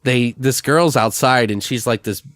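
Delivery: ordinary delivery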